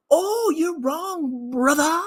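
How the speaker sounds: mocking sing-song taunt. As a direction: drag it out to mock them. Taunting the listeners.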